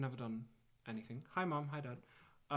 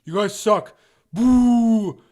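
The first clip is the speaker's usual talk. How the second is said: loud mock troll jeer, higher; mock heckler; 6 semitones higher, 16 dB louder, much slower than usual. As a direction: boo them like a gleeful troll. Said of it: "fifty comments of".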